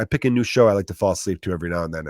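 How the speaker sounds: ordinary talk, nothing marked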